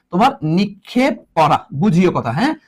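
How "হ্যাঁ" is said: normal talking voice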